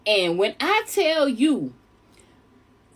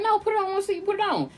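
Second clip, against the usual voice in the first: mock insistence; 11 semitones higher, faster than usual. Man insists she wears the gift.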